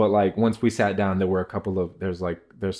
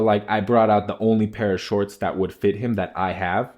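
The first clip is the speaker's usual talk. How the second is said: normal talking voice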